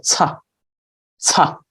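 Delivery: "ca" with a ts released off the tongue; demonstrated sound. Shown as a demonstration. Teacher showing where the sound is made, isolated "ca". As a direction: say "ca" as a separate ts syllable, pausing after it. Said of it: "comes between the tongue and the top".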